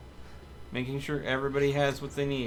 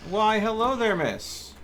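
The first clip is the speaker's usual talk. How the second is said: hammed-up gentleman's greeting, higher and louder; mock gallant greeting; 7 semitones higher, 4 dB louder than usual. Gamer greeting a zombie in mock politeness. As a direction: play the courteous host before firing.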